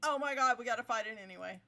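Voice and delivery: loud, rushed alarm; excited shout. Real, not acted. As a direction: blurt it out in a panic. Robot turns hostile suddenly.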